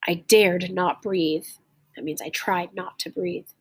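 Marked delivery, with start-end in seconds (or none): 0.1-1.5 clear, lively read-aloud storytelling; story narration. A narrator's reading. Teacher reading a story aloud to learners, lively storytelling. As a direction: read the tale to the class with feeling.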